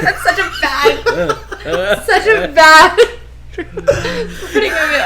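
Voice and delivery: slow, giggling through the words; laughing. Real, not acted. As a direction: laugh while saying it, embarrassed. Embarrassed by replayed clip.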